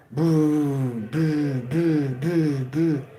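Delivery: booming stomp echoes; imitated stomping reverb. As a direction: boom it out like footsteps echoing. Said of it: "making a whole bunch of reverb go".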